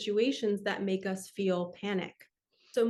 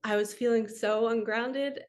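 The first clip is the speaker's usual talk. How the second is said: ordinary talk, nothing marked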